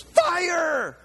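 high, loud yell of "Fire!"; shouted command. Retold or imitated. Aggie shouts at the firing squad, a loud high yell. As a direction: bark the word like a command. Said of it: "and he goes".

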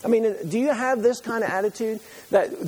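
usual speaking voice

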